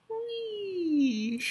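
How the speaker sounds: high tipsy squeal then giggle. Real, not acted. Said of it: "When I'm tipsy".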